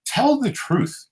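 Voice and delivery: high, slow, deliberate "Tell the truth"; emphatic urging. Shown as a demonstration. Urging the listeners, high, slow and emphatic. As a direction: land it as a command. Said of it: "urging listeners".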